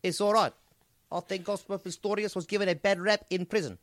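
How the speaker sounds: put-on loud, high South African accent; hammed-up Johannesburg accent. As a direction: bark it out as a comic South African. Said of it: "you sound a bit South African".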